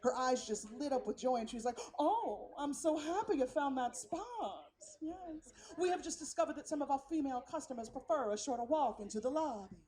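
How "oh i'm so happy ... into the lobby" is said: joyful Southern hostess, vowels stretched; smiling Southern concierge. Retold or imitated. Concierge lit up with joy to a parked guest, Southern sweetness. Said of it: "a genuine Southern smile".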